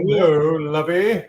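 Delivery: slow, drawn-out character greeting; slow impression of a sitcom character; Thurston Howell. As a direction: stretch the greeting out in a put-on voice. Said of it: "is that Thurston Howell".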